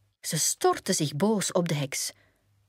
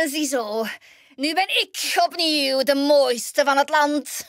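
hoog, traag en luider genietend heksengekraai; triomfantelijke heks; 9 semitones higher, 5 dB louder than usual. Acted. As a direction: geniet van je overwinning als boze heks. Heks juicht na de gifappel. (hoge trage triomfantelijke heksenstem)

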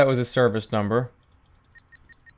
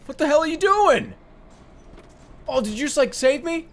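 much higher incredulous cry; startled disbelief; 11 semitones higher than usual. Real, not acted. Gamer reacting to an identical bus appearing, incredulous. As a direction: react to the bus rescuing you.

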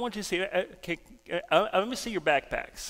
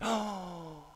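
long, soft gasped "oh"; acted-out hushed astonishment; awed; 7 dB quieter than usual. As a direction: breathe out in wonder. Friend sights something and reacts.

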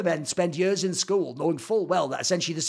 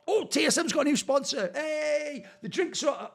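mock excitement; 9 semitones higher than usual. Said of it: "And then he goes".

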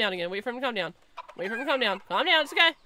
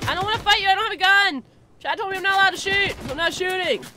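pleading shout; 6 semitones higher, 5 dB louder, much faster than usual. Real, not acted. Pleading with attacking player.

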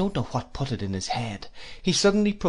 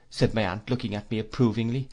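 usual speaking voice

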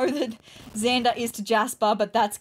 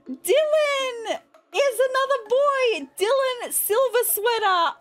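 excited; 11 semitones higher than usual. Real, not acted. Reads new baby's name.

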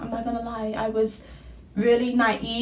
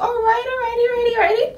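high, gleeful shouting; excited squeal; 13 semitones higher, much faster than usual. Retold or imitated. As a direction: squeal it out with glee. Hyping herself up.

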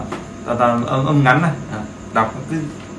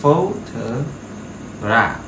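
Photograph dragged out syllable by syllable, PHO stressed; slow model word; much slower than usual. Shown as a demonstration. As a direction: say Photograph at crawling pace. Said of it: "trọng âm nó sẽ là phố".

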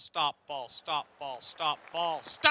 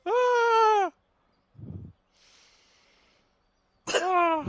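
high shrieking cackle; cackling witch laugh; 14 dB louder than usual. Real, not acted. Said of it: "I sound like a cackling witch".

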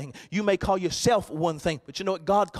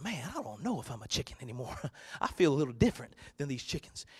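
softer, lower, musing little eaglet; doubting eaglet; 4 semitones lower, 8 dB quieter than usual. Acted. Eaglet in the coop quietly doubting he's a chicken. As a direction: wonder aloud, half to yourself.

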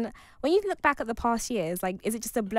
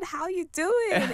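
put-on Chicago accent; 8 semitones higher, much slower than usual. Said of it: "in his Chicago accent".

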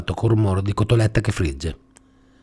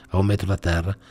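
ordinary delivery, nothing marked

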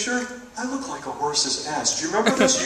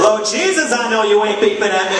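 higher, louder, more outraged sass; heightened sass; 6 semitones higher, 6 dB louder than usual. Retold or imitated. Honked-at driver, heightened outraged sass. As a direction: raise the stakes, outraged. Repeated with Lord Jesus.

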